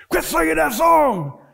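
high and loud command; mock sergeant shout. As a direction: yell the order.

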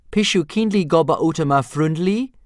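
high, very loud Dragonese. A narrator's reading. Reader teaches a polite dragon request, in high, loud Dragonese. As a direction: belt out the dragon phrase. Demonstrating dragon-language phrasebook examples.

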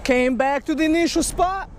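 high, slow, drawled accent in sheer excitement; excited put-on accent. Real, not acted. Showing viewers the crappie he found, in an excited slow accent. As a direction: stretch each word out, proud of the find. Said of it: "I don't like to do this accent".

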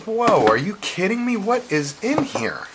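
high, drawn-out astonishment; amazed disbelief. Retold or imitated. Finding the surprise package in his box, high slow amazement. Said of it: "I'm like".

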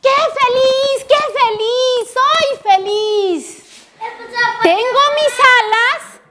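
high, drawn-out exclamation of joy; happy cry. A narrator's reading. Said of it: "Y él gritó de felicidad".

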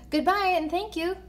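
lightly called-out, grateful goodbye; calling out. A narrator's reading. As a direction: call your thanks after someone departing. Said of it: "he called after her".

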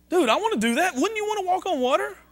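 high-pitched, excited imitation; eager dude. Preacher voicing someone wanting to walk on water, excited. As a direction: play an onlooker itching to join in. Imagined disciple eager to join Peter.

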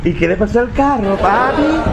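high teasing "papi" pushed up; mocking tone. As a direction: sing it out as sarcastic innocence. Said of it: "en un tono burlesco".

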